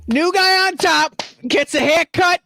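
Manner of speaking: high, slow wiseguy boast; Italian mobster voice. Retold or imitated. Voicing the new leader in his mobster accent. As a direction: gloat like a mob boss. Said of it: "new leader boasting".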